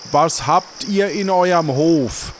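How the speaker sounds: normal talking voice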